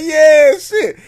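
high, loud imitation of the host; mock Shannon Sharpe. Podcast host mimics Shannon Sharpe, high and loud. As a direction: bark it out as the excited host. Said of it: "He was like".

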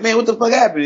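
high-pitched mock indignation; comic retelling of an annoyed outburst; imitated mad friend. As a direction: act out an irritated buddy demanding answers. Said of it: "He was mad".

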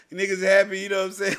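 ordinary delivery

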